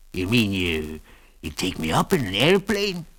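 put-on wheezy old prospector voice; wheezy old man. A narrator's reading. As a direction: rasp it out like a wheezy old miner. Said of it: "in a wheezy voice".

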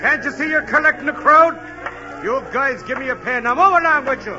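gruff cop. Acted. Cop shouting at stilt walker to move along. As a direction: order him off the street.

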